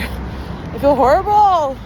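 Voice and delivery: high, slow drawn-out moan; moaned complaint. Real, not acted. Runner moaning mid-run, drawn out and high. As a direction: groan the words out in misery.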